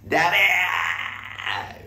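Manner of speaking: high, very drawn-out mock shout. Host replaying a comedian's accusation, mock shout. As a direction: call out the accusation in one long cry. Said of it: "で、西野さんが".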